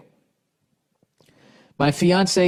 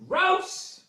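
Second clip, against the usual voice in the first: high squeal of disgust; 6 dB quieter than usual. Real, not acted. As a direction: shriek it, totally grossed out. Reacts to letter's phrasing.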